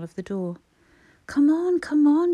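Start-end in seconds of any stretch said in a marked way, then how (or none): 1.3-2.3 high, loud, desperate pleading; urgently begging someone to open up; frantic cry. A narrator's reading. Mother at a locked door, frantic cry. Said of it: "she cried frantically".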